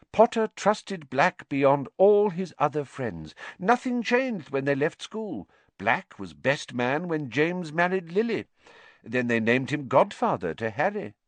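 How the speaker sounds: high-pitched, emphatic official; animated official telling the history; minister explaining. A narrator's reading. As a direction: recount the friendship like a pompous minister.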